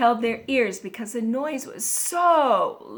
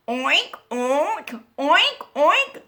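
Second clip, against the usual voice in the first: oinks climbing higher; squealing pig oinks; 4 semitones higher, slower than usual. A narrator's reading. Pig squeals at the squeaky bed, climbing oinks. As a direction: oink like a squealing piggy, rising in pitch. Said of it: "squealed the pig".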